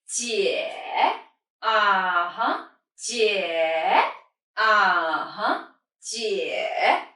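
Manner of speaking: JIE and UH-HUH dipping low, then rising; third tone. Shown as a demonstration. Teacher matches elder sister word to English, dipping JIE, UH-HUH. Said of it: "practice this third".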